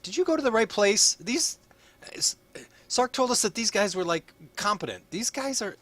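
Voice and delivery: squeaky, fussy official voice; high-pitched official voice. Acted. Guard-blaming official character voice.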